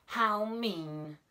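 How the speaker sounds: high, loud indignant cry; the little mole cries out in outrage. A narrator's reading. Mole shocked by the mess on his head, high loud cry. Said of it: "cried the little mole".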